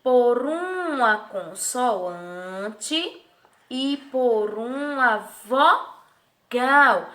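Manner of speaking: very slow, "consoante" stretched, "vogal" split as vo-gal; drawn-out answer. Shown as a demonstration. Teacher answering who forms the syllable, "consoante" and "vogal" drawn out. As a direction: hold "consoante", then break "vogal" in two.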